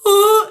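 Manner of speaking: loud, high startled gasp; freaked-out gasp. Retold or imitated. Imitating friend who hears breathing, loud frightened gasp. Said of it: "and she freaks out".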